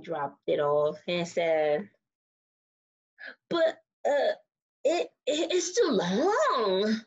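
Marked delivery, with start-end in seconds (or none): 3.5-7.0 higher, halting complaint with a drawn-out ending; slow, lightly voiced character protest; hesitant objection. Disappointed character objects to gift.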